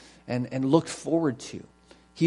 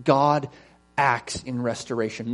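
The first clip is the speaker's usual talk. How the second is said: usual speaking voice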